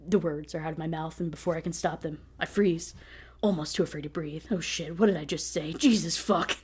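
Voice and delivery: ordinary talk, nothing marked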